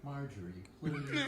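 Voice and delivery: trying to talk through laughter; stifle a laugh while speaking. Real, not acted. Laughing at wife's joke.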